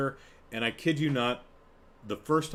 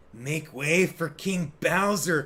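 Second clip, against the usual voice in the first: higher-pitched, slow creepy henchman; imitated sinister herald with an affected voice; Peter Lorre; 4 semitones higher, 4 dB louder, slower than usual. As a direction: announce it like an old-movie lackey, drawn out. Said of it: "this kind of Peter Lorre".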